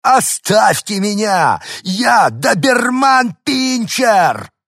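very high, loud, slow defiant shout; small dog proclaiming himself a Doberman; growling little dog. Acted. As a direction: shout it like a tiny fierce dog. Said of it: "рыкнул".

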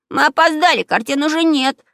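put-on high, urgent child voice; alarmed kid calling out; dismayed exclamation. Child finds the wall empty.

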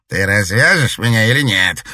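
loud angry demand; angry snap. A narrator's reading. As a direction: spit it at her angrily. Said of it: "злобно бросил ей Казиин". (злобно, громко)

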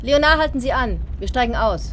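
loud call to the coachman. Acted. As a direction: shout up to the driver to stop. Ruft dem Kutscher zu. (laut dem Kutscher zurufen)

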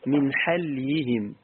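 من حليهم said slowly with ḥa open and a silent lam; Ruways reading demo. Shown as a demonstration. Teacher reciting the Ruways reading for students, slow. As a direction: say من حليهم as ḥalyihim, slow and deliberate. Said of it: "بفتح الحاء وإسكان اللام".

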